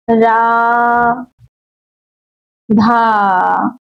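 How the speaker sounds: long stretched रा and धा, vowel held triple length; drawn-out vowels. Shown as a demonstration. Teacher stretching रा and धा for students. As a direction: hold the vowel of रा then धा three times as long. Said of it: "जैसे".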